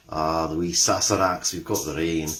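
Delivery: low-pitched, poorly attempted Scottish accent; bad Scottish accent. Shown as a demonstration. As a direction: have a clumsy go at sounding Scottish. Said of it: "my appalling attempt at a Scottish accent".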